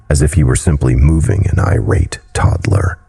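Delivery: usual speaking voice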